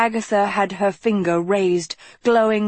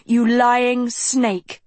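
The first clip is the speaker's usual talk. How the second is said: slow, raised, venomous hiss; 6 semitones higher, 3 dB louder, much slower than usual. A narrator's reading. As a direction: drag each word out with contempt. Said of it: "Agatha hissed".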